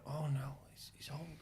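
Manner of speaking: whispered, worried murmur; hushed crowd whisper. Retold or imitated. Retelling the Wonka scene, the crowd whispers in alarm. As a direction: breathe it like a shocked onlooker.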